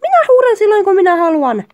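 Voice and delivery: loud, high defiant shout; acted angry yelling. Partner told there's no need to shout, yelling defiantly. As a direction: yell back defiantly, pitch leaping up. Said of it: "sinun ei tarvitse sitten huutaa".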